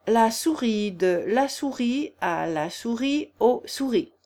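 slow schoolroom recitation; reciting a declension. A narrator's reading. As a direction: chant it like a grammar lesson. Reciting Latin grammar declension. (récitation lente de leçon de grammaire)

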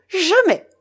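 loud, high cry of defiance; exclaimed refusal. A narrator's reading. Young woman refuses to marry the pirate, crying out. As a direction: fling the refusal back at full pitch. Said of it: "s'écria-t-elle".

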